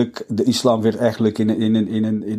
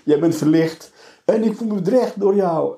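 sarcastic; 9 semitones higher than usual. Retold or imitated. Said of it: "Ik zeg, ja".

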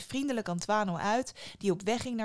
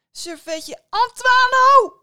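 much higher, louder scream; shrieking mother; 16 dB louder, much slower than usual. A narrator's reading. Mother shrieks at Antoine in rage. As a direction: scream his name in fury. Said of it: "gilde zijn moeder".

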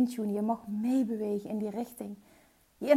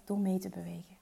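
whisper; 4 semitones lower, 7 dB quieter than usual. Real, not acted. Answering her own question softly.

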